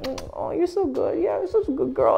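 very high, cooing praise; put-on sugary high voice; sweet-talking voice. Shown as a demonstration. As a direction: coo it up high with exaggerated sweetness. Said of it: "you contrast the sweetness".

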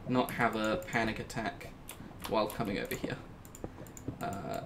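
normal talking voice

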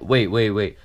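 ordinary delivery

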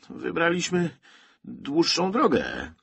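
high, drawn-out ironic answer. A narrator's reading. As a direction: stretch it out with mock innocence. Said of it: "Michał nie mógł powstrzymać ironii".

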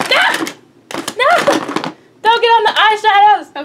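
high panicked shouts; screaming at a spider. Real, not acted. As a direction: yell in alarm at a spider. Spider running toward the eyeshadows.

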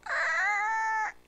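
high, drawn-out imitated brake screech; screeching halt. All the drivers braking suddenly, imitated screech. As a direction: squeal like tires stopping short.

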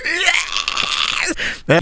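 high unvoiced yell of defiance; mock scream. Guitar player screaming back at the angry guy. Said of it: "screamed back like".

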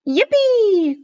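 high, loud, drawn-out cheer; gleeful storybook hooray; excited cry. A narrator's reading. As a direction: whoop with glee. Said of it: "cried Froggy".

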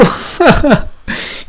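high breathy laugh after scoring; excited laughter. Real, not acted. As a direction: laugh in delight.

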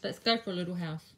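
talking around a paintbrush in mouth; mouth full. Real, not acted. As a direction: mouth full, words a bit muffled. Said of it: "I've just got my paintbrush in my mouth".

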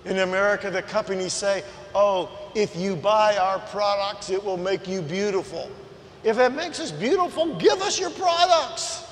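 loud, high preaching, shouting the point home; preaching at full force. Acted. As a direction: preach it big and loud, mocking the ad claims.

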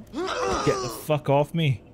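usual speaking voice

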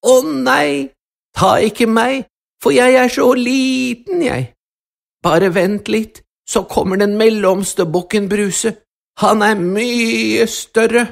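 high, pleading little goat; frightened little goat. Acted. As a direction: beg like a tiny scared goat. Little goat begs the troll.

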